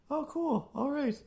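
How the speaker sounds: a high mock nonchalant okay; mock breezy reply. Mock casual listener reply.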